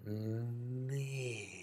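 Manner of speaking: long, soft humming "Me" felt in the chest; soft resonant me. Shown as a demonstration. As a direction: hum "Me" gently into the chest.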